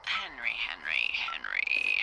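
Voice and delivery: slow teasing whisper. Acted. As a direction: breathe his name slowly. Calls him teasingly.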